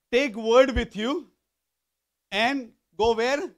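ringing, emphatic call-and-answer preaching; forceful preaching. Real, not acted. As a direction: drive the verse home to the congregation.

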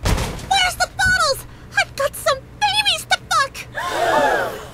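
mild mock yell. A narrator's reading. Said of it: "she yelled out".

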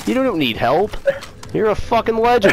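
high, hammed-up hype; played-up amazement. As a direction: gush over a teammate, laying it on thick. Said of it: "I gotta play it up".

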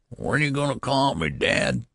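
put-on high, quick quavering old-man voice. A narrator's reading. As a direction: quaver the question up high, dropping on the last word. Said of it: "he quavered".

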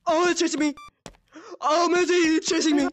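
panicked yelling, being chased; scared horror game streamer. Real, not acted. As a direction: yell in fright as it chases you.

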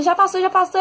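high mock call; imitando grito distante. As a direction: call it across the street, imitating him. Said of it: "do outro lado da rua gritando". (imitando grito do outro lado da rua)

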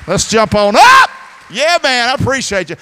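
high, loud, fired-up call to the room; excited shout. Real, not acted. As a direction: jump in with a burst of energy. Pastor rousing crowd after running late.

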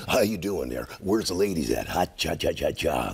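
lightly deep Busey voice; Gary Busey impression. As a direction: play a spaced-out Busey. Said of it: "Gary Busey in a gas station".

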